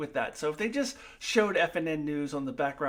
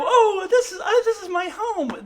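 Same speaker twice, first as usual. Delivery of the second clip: very high, loud mock tenderness; mock sentimental; 10 dB louder than usual. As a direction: coo it like fake heartfelt Mariner. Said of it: "and then go".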